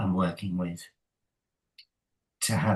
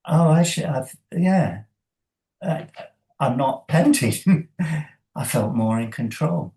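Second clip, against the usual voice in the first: high, louder delighted report; pleased swimmer; 5 semitones higher, 4 dB louder than usual. Retold or imitated. Swimmer tells the coach they are not panting, high and pleased. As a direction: surprise yourself with the good news.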